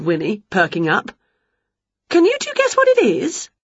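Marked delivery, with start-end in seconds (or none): 2.1-3.5 high, lively witch voice; bright eager witch teasing her pets; Winnie's voice. A narrator's reading. Witch quizzing the dog and Wilbur, high and lively.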